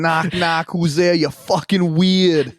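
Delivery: mock Boston accent. Co-host riffing on weird, hammed-up Boston accent. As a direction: ham up Boston, drawing out the punchline. Joke echoing the Boston bit.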